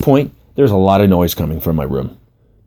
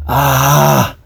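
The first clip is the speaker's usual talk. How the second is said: drawn-out "ah", pitched higher; strained grunt; 5 semitones higher than usual. A narrator's reading. Client under the masseuse's elbow, strained drawn-out grunt. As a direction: push the air out under pressure. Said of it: "My grunt was a combination of".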